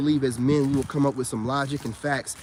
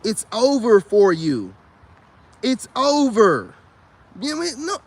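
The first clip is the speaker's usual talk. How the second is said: louder, slow, stretched out; drawn-out declaration; 5 semitones higher, 6 dB louder, slower than usual. Real, not acted. Vlogger telling women it's over, loud and slow. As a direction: drag each word out, loud. Emphatic dismissal.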